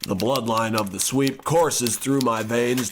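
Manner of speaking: usual speaking voice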